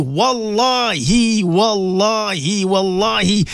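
sing-song supplication with long chanted ameens; swaying, chant-like congregational prayer; fervent prayer leading. Real, not acted. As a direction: lead listeners in heartfelt dua, stretching the holy words.

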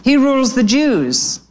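high-pitched proud little answer; pleased know-it-all. A narrator's reading. Harrow answers his brother, high and pleased with himself. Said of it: "pleased that he knew so much".

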